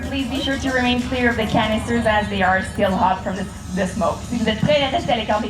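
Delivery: normal talking voice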